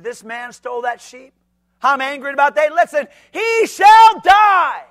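building to a slow furious shout; angry verdict. Retold or imitated. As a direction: ramp from complaint to death sentence. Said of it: "Notice what he said".

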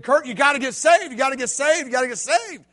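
loud, urgent call, fading; insist, then let it ease off; voice of God. Retold or imitated. God speaking to his heart.

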